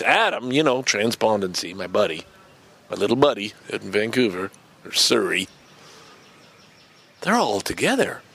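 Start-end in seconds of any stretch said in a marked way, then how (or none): none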